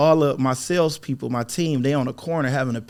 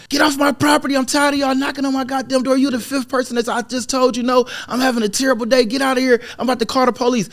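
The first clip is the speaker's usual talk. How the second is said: high, shrill-free furious rant at the door; an irate homeowner yelling, much higher; irate homeowner chasing salespeople off her porch, snapping angrily; angry snapping; 10 semitones higher than usual. Retold or imitated. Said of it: "start snapping".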